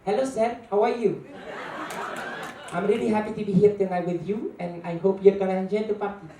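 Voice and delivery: high, bright put-on Indian English accent; mock Indian English host voice. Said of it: "I can make the Indian accent in English".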